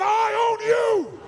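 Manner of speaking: loud high drawn-out I own you; shouted challenge. Shown as a demonstration. Coach leading the challenge again, loud shout. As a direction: yell it right in their face. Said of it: "Come on, do it again".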